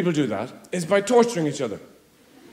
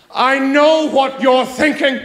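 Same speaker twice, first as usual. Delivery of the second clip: slow, knowing, self-satisfied; smug slow insinuation; 6 semitones higher, 4 dB louder, much slower than usual. Retold or imitated. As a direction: drawl it like a mind reader. Smug partner quote.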